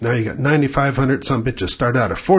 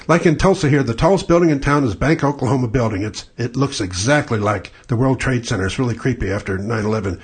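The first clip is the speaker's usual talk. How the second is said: ordinary talk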